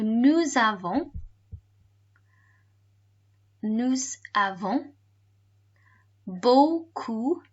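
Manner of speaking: slow "nous avons... beaucoup... de devoirs", piece by piece; phrase in chunks. Shown as a demonstration. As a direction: teach it chunk by chunk with gaps to repeat. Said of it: "And all together".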